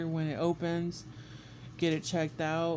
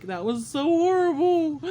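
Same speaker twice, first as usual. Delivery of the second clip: high, slow, louder anguished line; pained groan; 9 semitones higher, 6 dB louder, slower than usual. Retold or imitated. After the swab, eyes closed, anguished moan. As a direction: moan it, eyes still watering.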